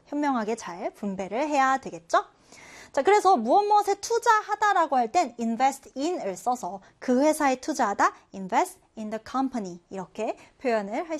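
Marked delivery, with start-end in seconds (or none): none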